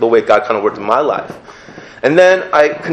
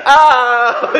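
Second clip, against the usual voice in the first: high, laughing mock yelp; hammed-up startled cry; 13 semitones higher than usual. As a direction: yelp like a kid caught off guard. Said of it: "I'm like".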